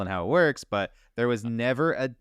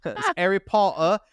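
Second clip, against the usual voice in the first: higher, louder hammed-up British accent; mock British accent like a Harry Potter fan; over-the-top imitation of a British accent; old British accent; 7 semitones higher, 4 dB louder than usual. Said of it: "I did the old British accent".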